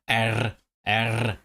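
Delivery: vowel gliding into a rolled R; trilled R. Shown as a demonstration. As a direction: roll each "R" on the tongue. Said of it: "from a vowel to the trill".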